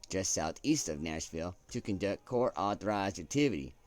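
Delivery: hammed-up broadcast read; news anchor accent. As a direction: deliver it like a network anchor. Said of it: "I don't know why I got into that accent".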